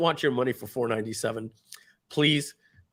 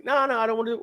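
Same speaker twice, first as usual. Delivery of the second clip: reluctant brother; 10 semitones higher, much faster than usual. Retold or imitated. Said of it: "He's like".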